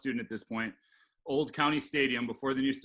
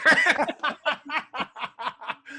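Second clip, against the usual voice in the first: high laugh; 12 semitones higher, 6 dB louder than usual. Real, not acted. Laughing at the story's punchline.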